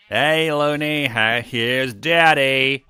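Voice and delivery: loud, slow, sing-song greeting; loud slow baby-talk daddy; doting daddy voice. Acted. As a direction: greet the pet like a fond dad. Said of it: "I love being able to do multiple voices".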